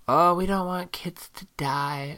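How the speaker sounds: high, slow, mock-pious whine. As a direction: drag it out in a falsely sincere whine. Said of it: "They wanna try to do it with".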